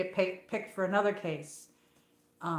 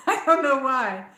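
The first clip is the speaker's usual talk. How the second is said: laughing through a puzzled repeat; 5 semitones higher, 4 dB louder than usual. Real, not acted. To her viewers, about being called New Jersey, laughing. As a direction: chuckle it out, amused and baffled.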